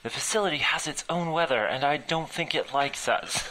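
panicked shout to leave now; urgent shout. Acted. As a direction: yell a warning over the storm. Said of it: "I shouted".

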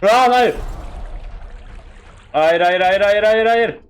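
panicked no. Real, not acted. Monster closing in, panics.

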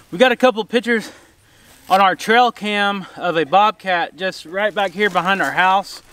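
ordinary talk

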